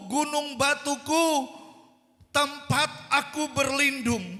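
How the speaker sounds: high, loud, emphatic psalm reading; full-force scripture proclamation. Real, not acted. Preacher proclaiming David's psalm to congregation, full-force. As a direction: declare each title of God forcefully.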